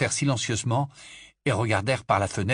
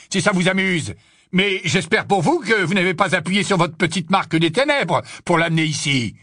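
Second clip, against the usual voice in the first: taunting barman, raised voice; defiant barman; 7 semitones higher, 6 dB louder than usual. A narrator's reading. Barman daring Death Eaters at his own door, defiant. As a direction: dare them with mocking questions.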